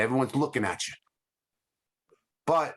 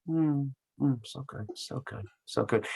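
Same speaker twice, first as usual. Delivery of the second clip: deadpan radio host; 4 dB quieter than usual. Retold or imitated. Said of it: "And they go like this".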